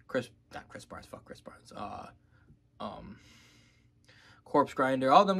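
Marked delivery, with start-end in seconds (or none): 0.1-3.2 unvoiced, much softer jab; whispered aside. Real, not acted. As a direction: hiss the aside under your breath. Aside dissing another singer.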